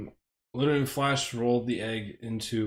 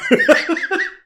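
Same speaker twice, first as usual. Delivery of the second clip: loud high laugh; 9 dB louder than usual. Real, not acted. Streamer cracking up at a chat joke, loud high laugh. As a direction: burst of shrieking high laughter.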